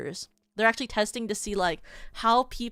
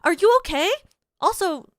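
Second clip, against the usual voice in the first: much higher and louder, chirpy iPad avatar; high cartoon VTuber voice; 10 semitones higher, 10 dB louder than usual. IPad VTuber asks fallen fan, high and loud. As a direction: squeak it out like a tiny screen mascot.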